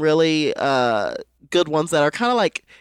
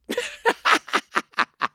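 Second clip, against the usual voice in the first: silent wheezing laugh; breathless, voiceless laugh; 12 semitones higher than usual. Real, not acted. Laughing at graham cracker joke.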